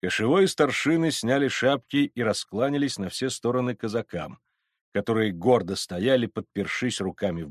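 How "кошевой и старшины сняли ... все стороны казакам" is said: ordinary delivery, nothing marked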